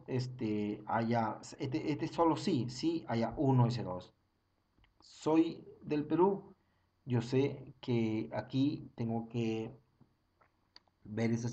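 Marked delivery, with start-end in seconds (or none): 5.0-6.5 "Soy del Perú" drawn out slowly, rising on Perú; slow demo. Shown as a demonstration. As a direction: stretch it out for the class. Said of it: "Otra vez". ("Soy del Perú" muy lento, subiendo en Perú)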